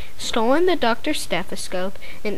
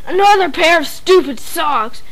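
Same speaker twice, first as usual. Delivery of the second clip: loud, higher bratty wail; bratty howl; 5 semitones higher, 6 dB louder than usual. A narrator's reading. Spoiled boy complaining to his parents, loud and bratty. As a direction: whine it out in disgust. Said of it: "howled Bradley".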